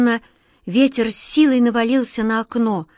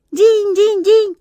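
very high, slow tinkling ring; carefree chiming; 12 semitones higher, 6 dB louder, slower than usual. A narrator's reading. Vikhrik chimes carelessly from under the ceiling. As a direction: ring out like bells without a care. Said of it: "беспечно прозвенел Вихрик".

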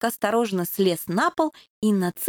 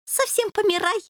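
sad; 8 semitones higher than usual. A narrator's reading. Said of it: "печально ответил Суслик".

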